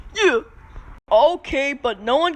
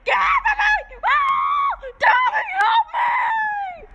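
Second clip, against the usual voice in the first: very high, shrill-pitched yell; high toy scream; slower than usual. Pretend.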